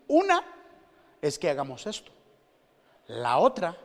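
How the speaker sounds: preacher explains a wife's two meanings, loud and stressed; emphatic contrast. Acted. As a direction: stress the two options hard.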